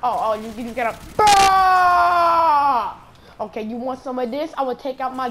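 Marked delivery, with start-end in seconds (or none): 1.2-2.9 high, very loud sustained holler; long loud yell. Pretend. Gamer proving he still has his mojo, long yell. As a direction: let out a huge drawn-out "bah".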